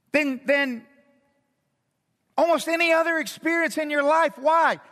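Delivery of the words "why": usual speaking voice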